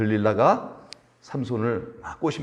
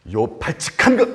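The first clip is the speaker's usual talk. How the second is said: playful, higher, louder telling-off; mock scolding; 5 semitones higher, 6 dB louder than usual. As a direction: scold her in hammed-up outrage. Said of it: "삼손이 이래야 되잖아요".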